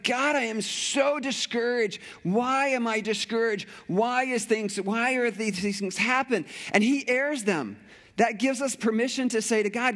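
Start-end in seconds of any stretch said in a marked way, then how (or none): 0.0-6.4 plaintive higher-pitched cry to God; mild impassioned cry of discouragement; imitated crying out. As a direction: voice the psalmist's despairing appeal, pitched high. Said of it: "he's crying out".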